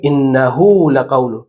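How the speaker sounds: «انه لقول» slowly, the ه in انه drawn long as hu; stretched ha. Shown as a demonstration. Teacher recites the example with ishba, slow. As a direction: lengthen the pronoun ha in انه.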